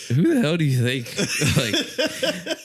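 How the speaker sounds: indignant quote broken by laughter; laughing quote. Retold or imitated. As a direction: laugh through the outraged question. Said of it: "And he's like".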